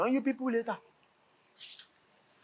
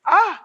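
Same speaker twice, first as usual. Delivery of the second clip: exclamation; 6 semitones higher, 6 dB louder than usual. Acted. Senator exclaims.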